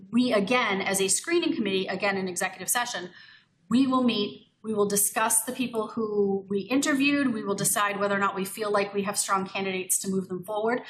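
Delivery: normal talking voice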